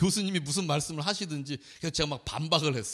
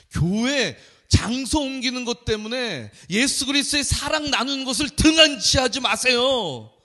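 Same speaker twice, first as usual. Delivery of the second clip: higher, louder preachy scolding; mock stern professor; 7 semitones higher, 8 dB louder than usual. Professor scolding the pastor in front of students, loud and preachy. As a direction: lecture him sharply, pitched up. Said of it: "저한테 갑자기 그러시는 거예요".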